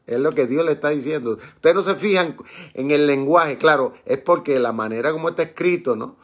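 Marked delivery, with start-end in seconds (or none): none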